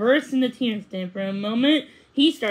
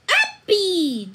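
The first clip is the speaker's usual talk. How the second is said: put-on toddler yell; 8 semitones higher, 3 dB louder than usual. A narrator's reading. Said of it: "the bean yelled".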